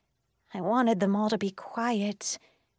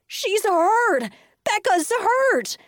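high, loud panicked yell; frantic high-pitched shouting; alarmed yell; 12 semitones higher, 7 dB louder, much slower than usual. A narrator's reading. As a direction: raise the alarm to everyone. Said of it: "Elijah yelled".

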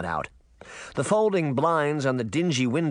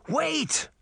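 shouting. A narrator's reading. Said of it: "Sabrina shouted".

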